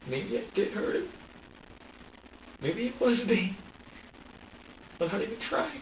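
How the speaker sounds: real distress, pitch climbing high; anguished self-doubt. Real, not acted. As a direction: voice rising as if about to break. Anguished self-doubt about hurting baby.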